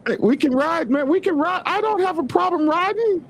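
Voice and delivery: squeaky high-pitched protest. Real, not acted. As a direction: voice shoots way up high. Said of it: "you got my voice up high like yours".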